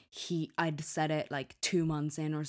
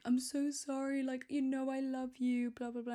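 much higher, pleading sorry voice; pleading apology; 9 semitones higher than usual. Retold or imitated. Girlfriend begs annoyed boyfriend's forgiveness, high and pleading. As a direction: beg forgiveness in a small high voice. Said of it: "and I'm like".

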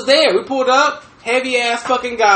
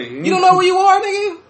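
high disbelief; 8 semitones higher than usual. Retold or imitated. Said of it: "I'm like".

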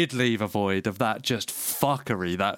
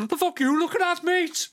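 a much higher, botched Liverpudlian challenge; bad Liverpool accent. Host doing a bad Liverpool accent of the streamer. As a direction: try a Liverpool accent and miss. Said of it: "that wasn't the right accent".

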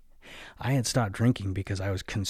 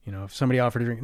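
ordinary talk

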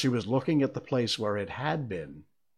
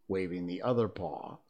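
ordinary delivery

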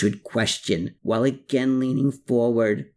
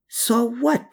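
ordinary delivery

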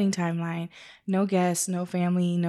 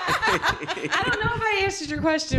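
high laugh through the words; 12 semitones higher than usual. Real, not acted. Laughing to the hosts about her answer. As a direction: giggle out the question.

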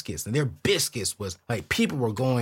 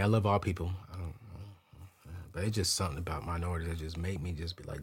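ordinary talk

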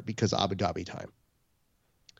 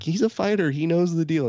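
high, louder insistence; mock defensive; 7 semitones higher, 5 dB louder than usual. Host retelling his answer to his wife, mock defensive. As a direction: brush off the worry playfully.

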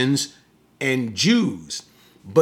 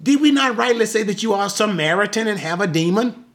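quick, higher-pitched indignant question; angry accusation; 6 semitones higher, faster than usual. Acted. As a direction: fire off a mildly angry challenge.

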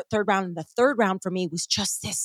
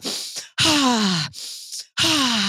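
big open-mouthed sighing "ha"; breathwork exhale; 3 semitones higher than usual. Shown as a demonstration. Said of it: "it's literally like a".

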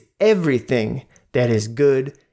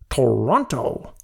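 put-on American announcer accent. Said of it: "he says Toronto like that".

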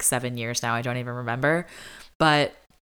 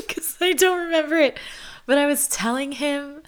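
laughing; 11 semitones higher than usual. Real, not acted. Laughing at herself.

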